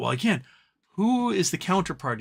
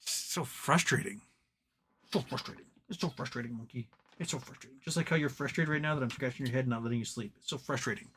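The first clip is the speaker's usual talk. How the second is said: quiet and fond, to the monkey; soft, teasing words to a sleepy pet; soft talk to a pet; 2 semitones lower, 8 dB quieter, faster than usual. Real, not acted. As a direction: murmur to the pet as you scratch its head. Talking softly to pet monkey.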